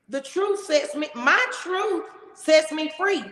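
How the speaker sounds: ordinary delivery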